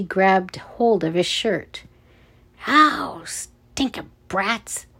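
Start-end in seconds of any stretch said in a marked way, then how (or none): 2.7-4.9 pained grumble. A narrator's reading. Guard kicked, grabbed again.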